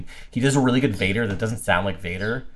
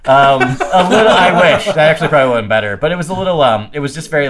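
usual speaking voice

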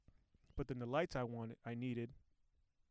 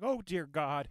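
retold dread; 2 semitones higher, 7 dB louder, slower than usual. Retold panic on waking sick.

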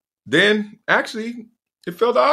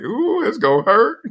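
teasing nurses; 9 semitones higher than usual. Retold or imitated.

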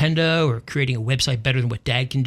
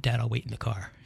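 put-on timid child; 3 semitones lower, 7 dB quieter than usual. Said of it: "She backed off and said".

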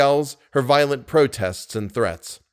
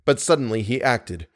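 ordinary delivery

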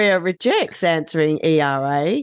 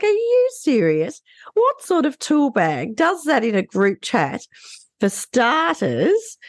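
high incredulous outburst, slowing at the end; 6 semitones higher, slower than usual. Retold or imitated. Co-host reacting to the group chat, incredulous and high-pitched. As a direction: ask it in high disbelief.

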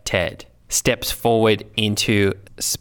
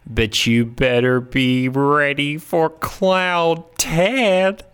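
heroic announcement; 6 semitones higher, much slower than usual. Acted. Hero announces his clown form.

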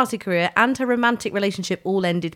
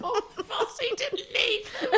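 laughing; 13 semitones higher, 8 dB quieter than usual. Pretend. Repeating the joke laughing.

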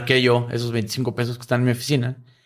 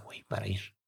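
whispered aside; 4 semitones lower, 17 dB quieter than usual. Retold or imitated. Friend recalling breaking his daughter's piggy bank, whispered. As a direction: drop to a whisper at the end. Friend confides a painful secret.

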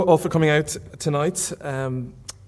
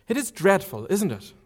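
put-on young woman's voice, higher, lightly protesting; reader's higher Marion voice; 7 semitones higher than usual. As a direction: lift the pitch for an eager young lady.

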